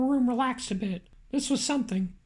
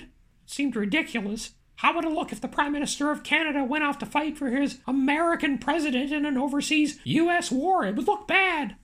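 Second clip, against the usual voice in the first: plain reading voice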